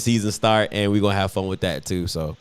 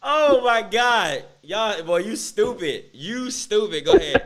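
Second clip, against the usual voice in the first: high, incredulous, laughing; cracking up in disbelief; 10 semitones higher, slower than usual. Real, not acted. Cracking up at the joke.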